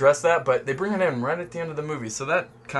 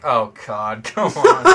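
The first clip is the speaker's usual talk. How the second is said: laughing groan, rising and louder on "come on"; laughing exasperation; 3 semitones lower, 5 dB louder, slower than usual. Retold or imitated. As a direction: roll your eyes and laugh it off. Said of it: "And I'm like".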